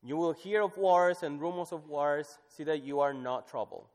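high, loud proclamation; scripture reading. Acted. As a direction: declaim the verse. Reads scripture aloud.

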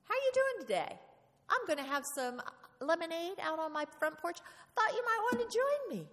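bright, high, loud knock-knock greeting at the neighbor's door; cheery neighbor greeting. Pretend. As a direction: play a bubbly neighbor inviting you over, pitched high.

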